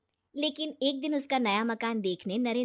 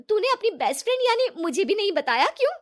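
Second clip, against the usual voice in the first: higher, louder, indignant disbelief; hurt surprise; 10 semitones higher, 7 dB louder than usual. Acted. Best friend shocked she wasn't told, indignant. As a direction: demand why you weren't told.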